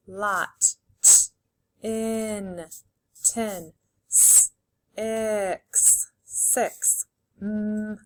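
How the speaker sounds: each sound said apart, then the whole word; sounding out letters. Shown as a demonstration. Teacher to young pupils, sounding out then blending words. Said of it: "Say the sounds".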